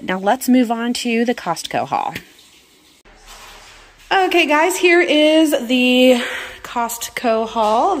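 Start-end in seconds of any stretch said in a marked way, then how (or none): 4.1-8.0 bright upbeat greeting, higher and louder; excited. Real, not acted. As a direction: launch the next segment with energy. Cheery intro to new haul.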